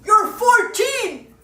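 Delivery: loud, stretched-out shout at a poser; exasperated yelling. Acted. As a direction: yell each phrase in mock outrage. Yelling at the posing boy.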